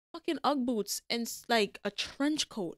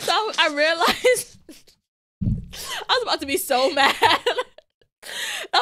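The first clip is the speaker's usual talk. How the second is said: high, louder, laugh breaking into the words; laughing while talking; 7 semitones higher, 6 dB louder, much slower than usual. Real, not acted. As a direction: tell it through real laughter. Laughing while retelling her annoyance.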